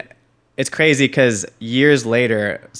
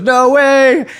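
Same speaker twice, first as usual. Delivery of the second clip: high, loud stunned exclamation; shocked when he sees the photo; friend's disbelief; 9 dB louder than usual. Retold or imitated.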